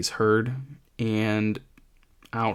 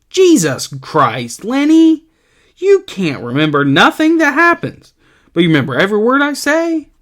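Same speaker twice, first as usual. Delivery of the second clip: much higher, louder outburst at Lenny; exasperated yell; 11 semitones higher, 8 dB louder than usual. Acted. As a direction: blow up in disbelief.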